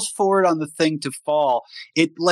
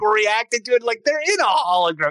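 ordinary talk

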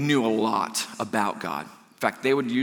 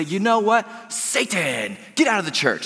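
Pharisees rebuke; 8 semitones higher, 4 dB louder than usual. Retold or imitated. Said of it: "they say".

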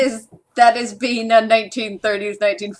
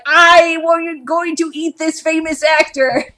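raised-pitch mock cat voice; cat's voice; 6 semitones higher than usual. Voicing the cat attacking Marlon Brando, raised mock declaration. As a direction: play the cat announcing its plan. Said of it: "He's just like".

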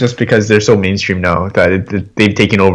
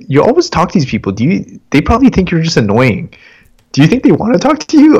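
put-on higher, teasing girlfriend voice, climbing to a squeak; teasing wife; 8 semitones higher than usual. Girlfriend teasing him about bothering Uber drivers, high mock voice. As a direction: needle him for chatting up drivers. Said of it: "She's like".

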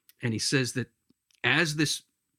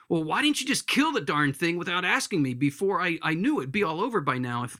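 higher, hurried grumbling protest; exasperated complaint; 7 semitones higher, faster than usual. Acted. Ghost blaming the angel for asking first, exasperated complaint. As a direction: complain like you've been put upon.

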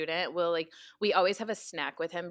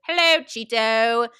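mock British accent; 4 semitones higher, 11 dB louder, slower than usual. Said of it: "in, like, his little British accent".

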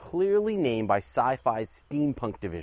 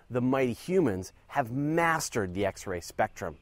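ordinary delivery

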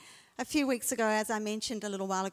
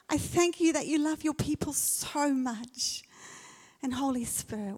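prayer. Acted. Leads a prayer.

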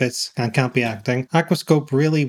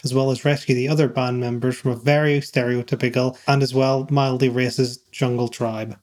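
ordinary talk

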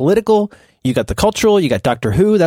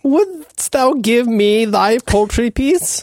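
high, slow olde English ham; drawn out like a mock courtier; shakespearean english; 7 semitones higher, much slower than usual.

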